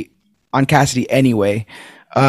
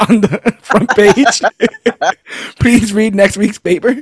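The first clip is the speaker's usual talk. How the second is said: laughing; 5 semitones higher than usual. Retold or imitated. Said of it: "maybe it just says".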